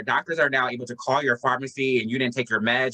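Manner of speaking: ordinary delivery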